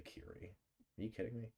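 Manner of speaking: ordinary talk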